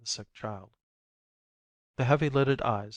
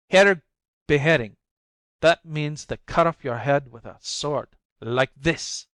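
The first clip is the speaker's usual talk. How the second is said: menacing, louder and higher; threatening; 3 semitones higher, 6 dB louder than usual. A narrator's reading. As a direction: threaten her, pressing. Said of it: "he threatens her".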